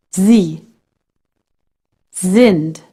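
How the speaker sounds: S before a vowel buzzed as Z in "Sie" and "Sind"; voiced German S. Shown as a demonstration. Teacher demonstrating S before a vowel, voiced like Z. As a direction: say "Sie. Sind." with each initial S like the Z in lazy. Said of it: "like the Z in the word lazy".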